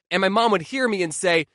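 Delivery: ordinary talk, nothing marked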